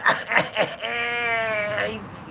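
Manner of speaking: long high cackle. Acted. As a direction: crow with laughter. Laughs loudly after his mock rebuttal.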